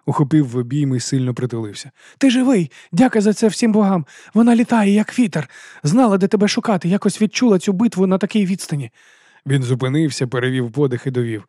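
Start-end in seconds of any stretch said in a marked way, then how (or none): 2.2-8.9 high, louder rush of relief; overjoyed jester. A narrator's reading. Jester hugging Fitz, breathless with joy. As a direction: blurt it out in joyful relief.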